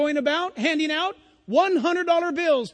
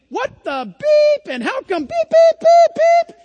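much higher, louder mock cursing; cussing rant; 12 semitones higher, 12 dB louder, slower than usual. Preacher imitating the cusser exploding in line, hammed-up yell. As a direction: blow up like an impatient customer. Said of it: "And he's saying".

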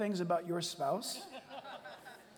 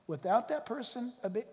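ordinary delivery

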